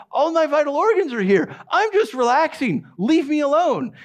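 high-pitched, guarded cat voice; defensive cat. Acted. Cat to someone who comes to pet it, defensive high protest. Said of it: "because it says".

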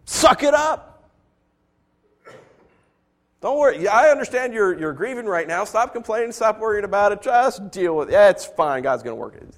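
mock the careless comforter; mocking dismissal. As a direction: comforter telling a grieving friend to just get over it. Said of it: "and you're saying".

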